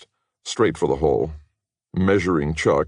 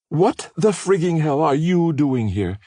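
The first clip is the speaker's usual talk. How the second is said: higher, louder angry upper-class Philadelphia voice; Philadelphia mainline accent; 13 semitones higher, 5 dB louder than usual. A narrator's reading. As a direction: Katie snapping back in her Philadelphia mainline accent. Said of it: "Katie replied in her Philadelphia mainline accent".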